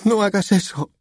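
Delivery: high-pitched desperate plea; daughter's high, upset begging; tearful imploring. A narrator's reading. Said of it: "imploró ella, echándose a llorar".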